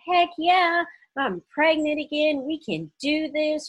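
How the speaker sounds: high, loud, retold cheer; elated celebration. Woman celebrating being pregnant again, elated. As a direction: cheer it out, pitched well up.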